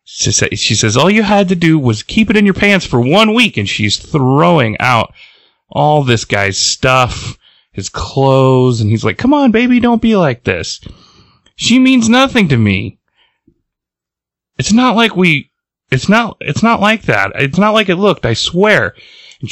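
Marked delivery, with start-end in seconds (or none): 1.0-3.6 high-pitched retold scolding. Girlfriend throwing out cheating boyfriend's stuff, scolding. 9.2-18.9 high, whining mock pleading. Cheating boyfriend begs girlfriend from the street, pleading. Caught boyfriend begging her.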